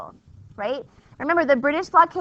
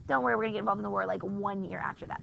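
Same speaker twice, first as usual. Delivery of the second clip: quiet aside; 5 semitones lower, 9 dB quieter, faster than usual. Real, not acted.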